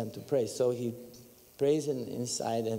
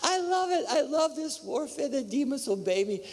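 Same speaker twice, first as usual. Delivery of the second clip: much higher, gleeful voice of a wife loving spiritual warfare; delighted wife; 11 semitones higher than usual. Retold or imitated. Mimicking his wife jumping on the hotel bed, a high gleeful voice. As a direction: play the thrilled wife, up high and bright with glee.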